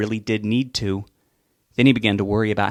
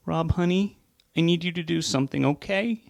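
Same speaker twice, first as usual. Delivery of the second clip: voice cracking; 8 semitones higher than usual. Acted. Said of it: "Her voice cracked like she was about to start crying".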